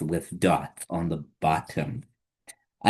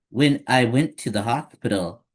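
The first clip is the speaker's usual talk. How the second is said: usual speaking voice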